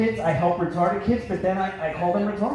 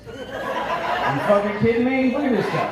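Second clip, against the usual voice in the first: grumpy Philly GPS. Retold or imitated. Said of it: "my G P S is like".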